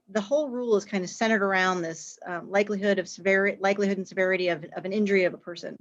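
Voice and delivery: quick, rushed explanation; really fast. Real, not acted. Panelist explaining the injury severity rule to webinar viewers, really fast. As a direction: rattle it off at speed. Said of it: "I feel like I said that really fast".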